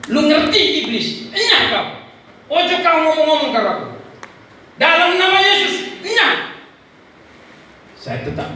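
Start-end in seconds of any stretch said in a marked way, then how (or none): 0.1-6.5 loud, high command to begone; fierce rebuke. Retold or imitated. Father casting out the devil, fierce rebuke. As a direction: drive the devil out with shouted orders.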